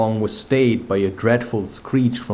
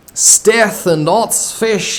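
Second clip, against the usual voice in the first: higher, louder and slow pirate oath; thundering; 9 semitones higher, 4 dB louder, slower than usual. A narrator's reading. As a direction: boom it out like an enraged captain. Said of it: "he thundered".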